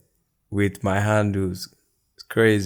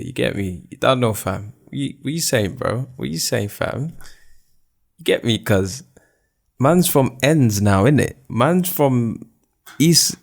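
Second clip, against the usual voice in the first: put-on playful London street accent, lilting higher in places; English accent; 3 semitones higher, 5 dB louder than usual. Podcaster mimicking Top Boy after watching it, mock English accent. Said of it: "my English accent is entering".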